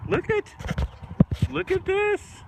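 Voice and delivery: very high, thrilled exclaim; excited squeal. Real, not acted. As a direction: squeal with delight at the goats. Excited at goats climbing.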